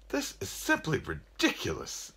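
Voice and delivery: high, fussy indignant gentleman; respectable ghost. A narrator's reading. Respectable ghost confiding in the narrator, prim indignation. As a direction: put on a prim, high-pitched complaint. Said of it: "quieter and more respectable ghosts".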